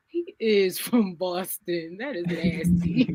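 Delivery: slow, drawn-out mock disgust; slow, deliberate mock-appalled reaction; retold disgust. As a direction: quote your verdict, dragging every word. Retold disgusted reaction to Boston accent.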